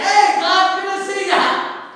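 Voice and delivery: loud, high call of welcome; hearty greeting. Acted. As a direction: hail an old friend across the store. Hearty greeting to God acted out.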